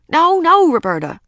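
high, loud burst of alarm; alarmed cry. A narrator's reading. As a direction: cry out to stop the baby. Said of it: "cried Ramona".

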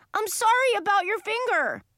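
very high, loud apology; upset boy. A narrator's reading. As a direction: blurt an apology in a high child's voice. Child apologises amid yelling fight.

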